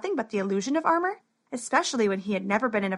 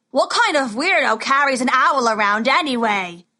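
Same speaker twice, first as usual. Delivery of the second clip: laughing taunt; 2 semitones higher, 7 dB louder than usual. Acted. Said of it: "laughed another".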